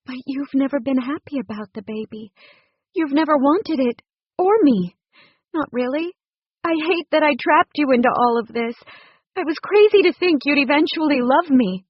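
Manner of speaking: lightly voiced distressed woman confessing her fears; wife's voice. A narrator's reading. Woman's voice, upset.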